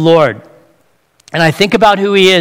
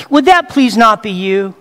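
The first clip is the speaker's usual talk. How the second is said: ordinary talk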